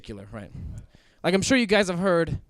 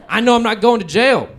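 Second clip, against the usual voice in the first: loud, higher burst of triumph; triumphant; 4 semitones higher, 8 dB louder than usual. Retold or imitated. Driver gloating to himself about the cop, triumphant. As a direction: crow it out loudly, sure you're in the clear.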